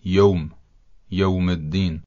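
یوم said "yowm", in a Persian accent; Persian-accented یوم with an o vowel. Shown as a demonstration.

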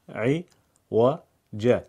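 "عوج" split very slowly into syllables. Shown as a demonstration. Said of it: "هجا هجا بخونید".

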